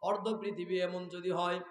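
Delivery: normal talking voice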